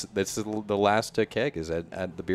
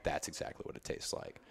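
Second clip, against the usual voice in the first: whispered; 6 semitones lower, 14 dB quieter than usual. Retold or imitated. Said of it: "I was like".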